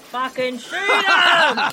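loud, slow yell at the screen; cheering shout. Real, not acted. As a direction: shout it out to the hero.

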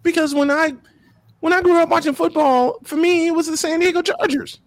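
excited, pitched high; nostalgic excitement. Real, not acted. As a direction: lit up remembering the team. Nostalgic about childhood team.

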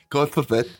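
ordinary talk

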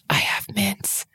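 whispered secret. Retold or imitated. Friend confessing near the security line, in a whisper.

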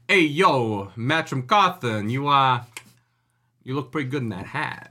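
hammed-up New Jersey wiseguy come-on; mock New Jersey mobster drawl; New Jersey goomba accent. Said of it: "used a New Jersey Goomba".

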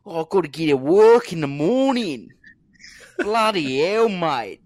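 put-on loud, high Australian accent; hammed-up Aussie bloke moaning; aussie tradesman. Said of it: "They go".

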